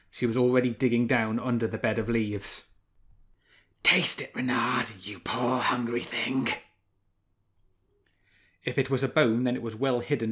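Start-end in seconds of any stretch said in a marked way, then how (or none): 3.8-6.5 soft she-wolf voice. A narrator's reading. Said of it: "her look was as soft as her voice".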